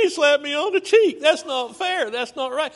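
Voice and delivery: high, loud mock whine; whiny complainer. Preacher mimics a churchgoer griping about a slap, whining. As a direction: whine like a wronged child. Mocking a whiner slapped on cheek.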